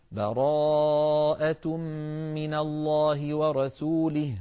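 drawn-out chanted opening of the sura; slow Quran recitation. Shown as a demonstration. Teacher starting the new sura after a full stop. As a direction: chant براءة long and slow. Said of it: "ثم نقول".

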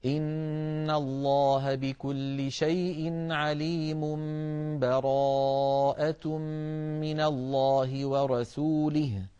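slow chant flowing straight from عليم into براءة; connected slow recitation. Shown as a demonstration. Teacher demonstrating joining the two suras. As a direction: chant it through with no stop. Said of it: "أن نصل فنقول".

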